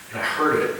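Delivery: ordinary talk, nothing marked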